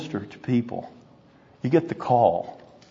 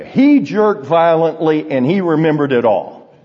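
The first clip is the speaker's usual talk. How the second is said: emphatic; 6 semitones higher, 6 dB louder than usual. Real, not acted. Preacher's emphatic punchline.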